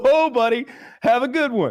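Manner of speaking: high, loud chummy brush-off; mocking bug man. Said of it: "And he's just like".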